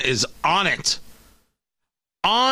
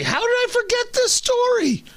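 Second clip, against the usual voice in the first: high exclaim. Real, not acted. Mock self-scolding exclamation.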